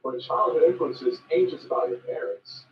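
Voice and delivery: ordinary delivery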